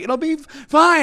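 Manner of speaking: high-pitched, faltering mock politeness; polite reassurance. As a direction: imitate nervous polite reassurance. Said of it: "they did the polite, like".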